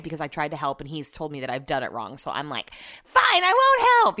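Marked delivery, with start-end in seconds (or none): 3.0-4.2 high, loud, slow mock huff; hammed-up sulky pout; huffy retort. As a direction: fling it back in a sulky huff. Retold huffy reply in argument.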